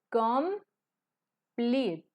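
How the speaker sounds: "complete" en dos golpes, com... plit; separado en sílabas. Shown as a demonstration. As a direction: pausa marcada entre las dos sílabas de "complete". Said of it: "Complete tiene dos sonidos". ("complete" dicho sílaba por sílaba, com-plit)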